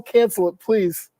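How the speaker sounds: normal talking voice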